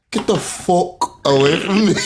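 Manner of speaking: imitating Zena. Laughing while imitating Zena telling someone to get away. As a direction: mimic her through a laugh, high. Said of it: "I like how Zena says".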